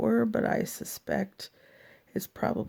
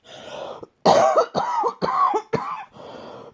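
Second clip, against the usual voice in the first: a long, harsh, real cough that won't stop; hard coughing fit; 4 dB louder than usual. Real, not acted. Sick woman telling her viewers about her illness, coughing fit. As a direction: cough hard from the chest, then apologise.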